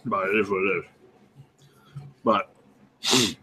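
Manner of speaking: chewing while talking; mouth full. Real, not acted. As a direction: talk around a bite of food. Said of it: "I'm trying to finish my food".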